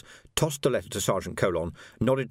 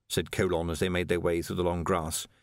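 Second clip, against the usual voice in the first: ordinary delivery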